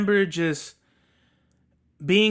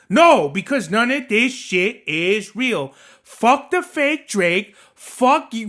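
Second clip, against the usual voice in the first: angry rant; 2 semitones higher, 4 dB louder, slower than usual. Real, not acted. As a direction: spit out the disgust. Ranting about fake [name].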